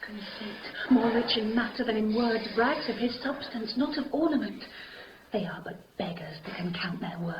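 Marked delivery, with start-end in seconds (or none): 5.3-7.3 soft whispered line; whispered love line. Acted. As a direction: hush it as a secret. Juliet softly to Romeo.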